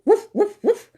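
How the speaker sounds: light puppy bark; dog barking. Acted. Dog voiced.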